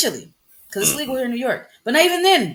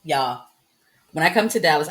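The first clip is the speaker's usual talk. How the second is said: ordinary talk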